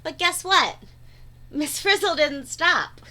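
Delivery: plain reading voice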